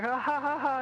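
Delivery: high, forced laugh to play it off; fake laugh. Shown as a demonstration. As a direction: shrug it off with a put-on laugh. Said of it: "just play it off".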